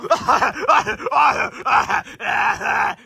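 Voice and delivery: loud high cartoon laugh; high triumphant laugh. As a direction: laugh like a victorious cartoon hero.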